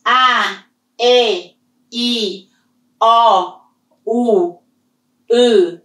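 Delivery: each vowel held on one level tone; teacher drilling tones; vowels in the same tone. Shown as a demonstration. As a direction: lock every vowel into the same pitch. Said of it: "locking into the same tone".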